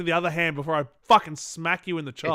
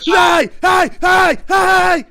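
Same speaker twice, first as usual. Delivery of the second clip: much higher, much louder mock yelling; mock shout; 13 semitones higher, 11 dB louder, much slower than usual. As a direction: bang the cage and yell hammed-up. Yelling at caged child, joking.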